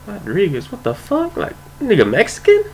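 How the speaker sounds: retold bafflement. Retold bafflement at an unexpected surname.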